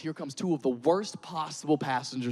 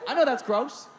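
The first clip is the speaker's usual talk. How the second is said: exasperated plea; 10 semitones higher than usual. Pretend. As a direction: beg for the dad's attention.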